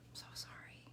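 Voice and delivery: I'm so sorry fading to a bare whisper; whispered condolence. Retold or imitated. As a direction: let the second sorry die away into breath. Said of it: "we typically say is".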